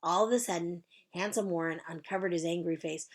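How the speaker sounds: plain reading voice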